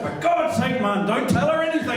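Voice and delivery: chirpy mimicry of a mate calling; imitating Davey. Voicing Davey on the phone.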